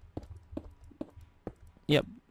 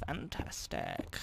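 sarcastic whisper; 4 semitones higher, 14 dB quieter, slower than usual. Real, not acted. Sarcastic unvoiced aside.

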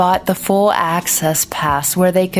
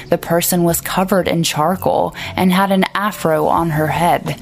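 ordinary delivery

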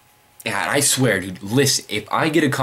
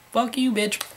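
mocking. Mocking insult to haters.

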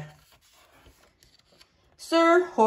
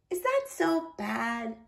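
soft, slow question; gentle child; 3 semitones higher, 8 dB quieter than usual. A narrator's reading. Niece to blushing uncle, gentle asking. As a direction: ask it tenderly and quietly.

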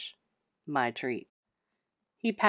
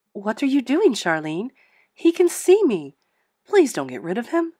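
much higher and louder, begging in alarm; desperate pleading; 5 semitones higher, 9 dB louder than usual. Acted. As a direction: plead frantically so she won't give the cat away. Ghost begs to keep the cat.

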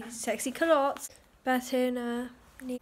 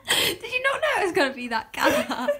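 high, giggling question; laughing; 11 semitones higher than usual. Real, not acted. Laughing at her friend over the drink's colour. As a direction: ask it through the giggles.

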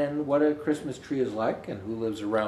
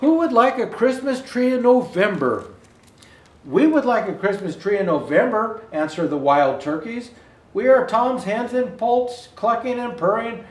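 bright, lilting storybook voice; gentle animated read-aloud; reading to children; 5 semitones higher, 7 dB louder than usual. Acted. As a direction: read it like a picture book to little kids. Reads picture book aloud.

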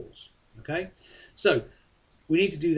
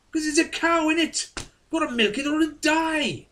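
put-on much higher, louder Welsh farmer accent; 7 dB louder than usual. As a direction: imitate the Welsh dairy farmer, pitched way up and loud. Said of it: "I'm gonna do the accent".

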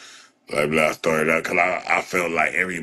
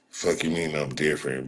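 ordinary talk, nothing marked

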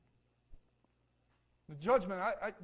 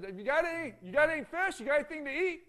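raised voice calling across the water; Jesus shouting from the shore to the fishermen; 7 semitones higher, 7 dB louder than usual. Retold or imitated. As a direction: call out to the boat, higher and louder. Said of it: "Jesus yells out to him".

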